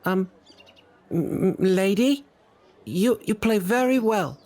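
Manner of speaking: put-on high, hesitant child voice; little boy. Little boy shyly compliments the violinist, high child's voice. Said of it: "The little boy looks up at you".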